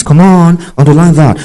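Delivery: rally the students to mark it; excited urging. Real, not acted. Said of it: "urging the class, excited".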